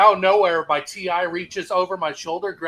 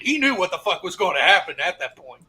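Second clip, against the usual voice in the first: normal talking voice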